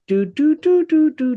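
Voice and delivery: high, sung-out dial tone beeps. Retold or imitated. Mum dialling the nan on the shared line, high beeps. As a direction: beep like keypad tones.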